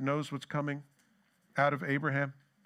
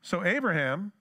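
ordinary talk

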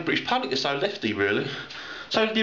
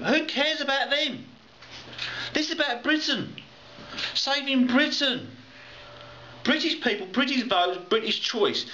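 high, heated outrage at the politician; worked-up pub rant, voice pitched up; exasperated rant; 8 semitones higher than usual. Real, not acted. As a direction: climb up into indignant disbelief. Ranting against Cameron.

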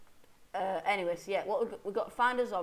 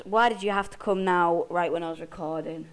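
annoyed; 8 dB louder than usual. Real, not acted.

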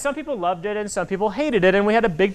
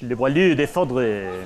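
French pronunciation; 7 semitones lower, slower than usual. Shown as a demonstration. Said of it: "in French".

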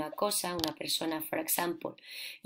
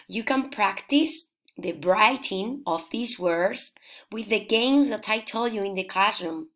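ordinary talk

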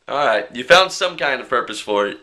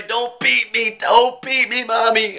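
higher, hammed-up childish begging; mock pleading; 7 semitones higher, slower than usual. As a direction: plead like a kid afraid of a spanking. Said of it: "mock begging after apology".

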